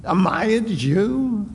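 high scornful taunt at a beaten prisoner; mocking Pilate. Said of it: "Pilate, you know, he says".

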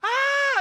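loud, high-pitched scream; sudden hammed-up shriek of fright; imitated terrified scream. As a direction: scream out in mock terror. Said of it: "it was like screaming".

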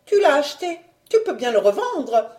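high, loud opening jab; scornful retort. Acted. Wife retorts about her husband's bought title, high scornful voice. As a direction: throw it back at him, starting high.

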